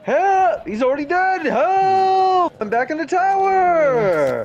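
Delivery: high yelling for help. Real, not acted. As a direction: shout for help to teammate. Calling for help in game.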